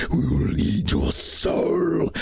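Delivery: slow, breathy whisper from the snake; demonic snake voice. Acted. Snake on the receptionist threatens the players, slow whispered menace. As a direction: let the snake hiss a threat, slowly. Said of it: "He gets a little testy".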